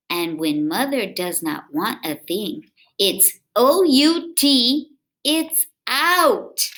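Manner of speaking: put-on lively read-aloud for kids; storybook reading. A narrator's reading. Reading a picture book aloud to children, lively.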